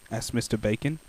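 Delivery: ordinary delivery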